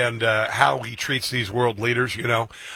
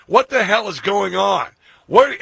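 high, loud mock-angry GPS squawk; playing a stressed-out nagging GPS, hammed up; mad GPS voice; 5 semitones higher, 5 dB louder than usual. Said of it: "She was sounding mad".

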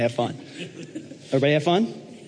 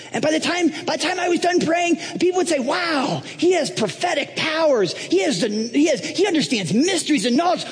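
very high, excited self-promotion; Donald Trump; 14 semitones higher than usual. Acted. Trump boasting to Paul of his powers, high and excited. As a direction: get carried away, voice pitched way up. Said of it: "And then he's like".

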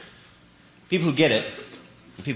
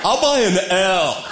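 dopey contestant; slower than usual. Retold or imitated. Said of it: "I need to buy a vowel".

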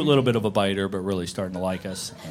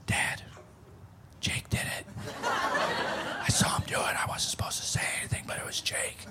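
hushed, confiding tattletale whisper; imitated conspiratorial whispering; child's secretive whisper; 9 dB quieter than usual. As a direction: whisper it like a kid telling on someone. Daughter secretly tattles on brother.

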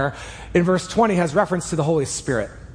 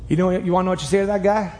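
ordinary talk, nothing marked

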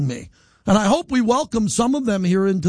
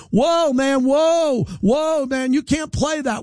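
high, drawn-out halting cries; alarmed cops; 5 semitones higher, slower than usual. Retold or imitated. Cops rushing in to stop the radio host, high alarmed protest. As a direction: stop them with stretched, raised whoas.

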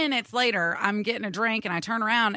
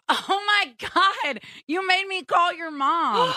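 imitating girl; 8 semitones higher, slower than usual. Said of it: "I hear this girl go".

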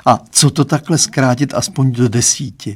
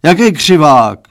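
loud, raised outburst; roared; 3 semitones higher, 7 dB louder than usual. A narrator's reading. Father exploding at daughter's correction, loud roar. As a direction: roar the question back. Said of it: "zařval pan Stránský".

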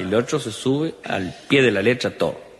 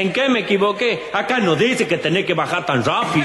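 fallen rider's question; 9 semitones higher than usual. Acted. Dialogue after fall.

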